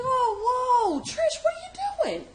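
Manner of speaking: high, loud alarmed cry of her name; startled yelling at her to explain; alarmed shout. Retold or imitated. Boyfriend yelling at her on the scooter, high alarmed shout. Anthony voiced, alarmed in story.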